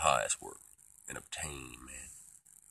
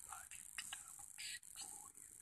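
very soft, voiceless threat; hushed, whispered vow to destroy; menacing whisper; 11 dB quieter than usual. Pretend. Quiet threat after boast of authority.

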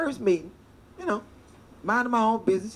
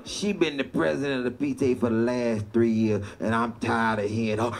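put-on grumbling old man; 8 semitones lower than usual. Old man quoted again.

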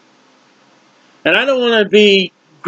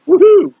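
excited whoop; 10 semitones higher, 4 dB louder than usual. Acted. Wild exclamation mid sermon.

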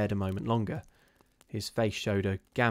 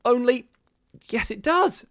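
very high, loud horrified assent; horror-stricken; 8 dB louder than usual. A narrator's reading. Missus Brigham agreeing with her sister, horror-stricken. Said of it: "whose horror-stricken tone matched her sister's".

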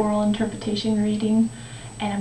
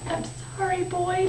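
soft, higher-pitched apology; sorrowful; 6 semitones higher, 5 dB quieter than usual. Acted. The tree apologises to the boy, sorrowful. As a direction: apologise gently, small and high. Tree has nothing left to give.

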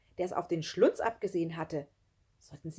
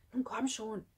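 raised, pressing plea; urging; 4 semitones higher than usual. A narrator's reading. Schlunz pushes Nele to get moving, urgent prodding. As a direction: hurry her along without shouting. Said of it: "drängte Schlunz sie".

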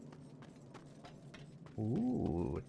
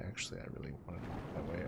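quick, quiet sarcastic mutter; tossed-off sarcastic correction; sarcastic aside; 3 semitones lower, 9 dB quieter, much faster than usual. Real, not acted. Sarcastic aside after being blocked.

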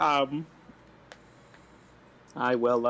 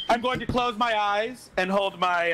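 ordinary delivery

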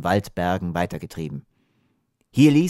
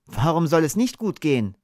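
high, proud retort; confident boy's voice, pitched up; proud call; 7 semitones higher than usual. A narrator's reading. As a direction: answer back with pride. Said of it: "rief Hapeda stolz".

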